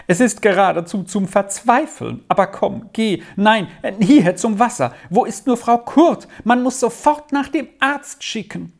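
high, flustered urgency; fretful wailing. A narrator's reading. Aunt hurries to send for the doctor, fretful wailing. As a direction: fuss in a rising, agitated voice. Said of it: "jammerte Tante Ninette weiter".

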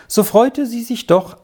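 ordinary delivery

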